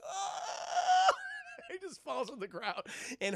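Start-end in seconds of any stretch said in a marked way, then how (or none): 0.0-1.7 high mock pain yelp; pained cry. Johnny kicked in the groin, high mock pain cry. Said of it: "He's like".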